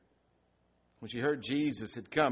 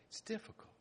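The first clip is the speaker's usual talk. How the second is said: a whispered admission; 12 dB quieter, faster than usual. Real, not acted. Pastor admitting grief is hard, whispered. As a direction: let it drop to a whisper. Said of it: "all my life as a pastor".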